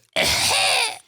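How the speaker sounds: high squealed rapper ad lib; ad lib imitation. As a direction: yelp it way up high. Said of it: "his ad lib is".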